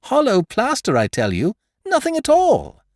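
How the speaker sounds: very high, loud repeat; triumphant cry. A narrator's reading. Beautrelet repeating to the magistrate, triumphant cry. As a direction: hammer the word home.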